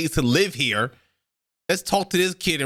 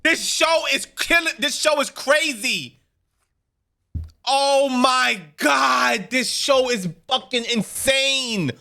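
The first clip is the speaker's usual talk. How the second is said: excited; 7 semitones higher, slower than usual. Real, not acted. Viewer still raving about the episode.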